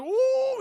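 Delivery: high, loud whoop of mock fright; imitated yelp at a sudden scare; hammed-up high-pitched "ooh"; startled "ooh". Imitates boyfriend seeing the knife.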